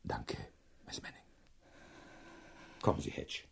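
hushed whisper. Acted. Professor thanks patient and tells Hedge softly. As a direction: whisper and slip away. Leaving the sickroom.

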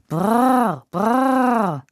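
loud "brrr" to halt a horse; coachman's whoa. A narrator's reading. Coachman reins in the restless horse, calling whoa. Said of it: "zerrte an den Zügeln und rief".

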